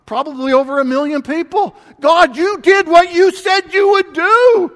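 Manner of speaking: fast, loud, rising cry of praise; building to a near-shout of wonder; voicing David's thrilled praise to God; excited praise. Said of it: "and he would have".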